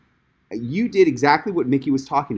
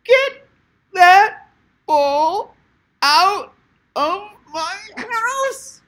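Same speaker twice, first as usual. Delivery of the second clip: slow high shriek, word by word; outrageous voice; 5 dB louder, much slower than usual. Shown as a demonstration. Coach demonstrating another way to yell at kids, drawn-out outrageous shriek. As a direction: stretch each word into a high screech. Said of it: "you could do it like".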